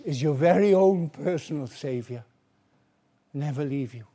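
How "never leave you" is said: usual speaking voice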